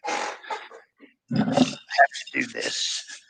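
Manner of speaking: strained, slow, breathy effort; straining. Real, not acted. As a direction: squeeze it out while lifting. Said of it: "try not to grunt and groan".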